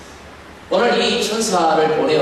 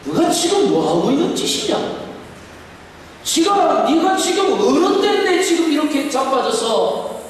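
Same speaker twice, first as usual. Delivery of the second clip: much higher, berating shout at a lazy prophet; God scolding Elijah in a high, harsh voice; 7 semitones higher than usual. Pretend. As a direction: bark it like a harsh master. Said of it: "이렇게 윽박 지르지 않았습니다".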